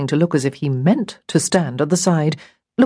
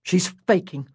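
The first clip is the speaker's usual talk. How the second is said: soft, unimpressed remark; understated big-brother scepticism; 5 dB quieter than usual. A narrator's reading. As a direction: dismiss it, unimpressed. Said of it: "Daniel was unimpressed".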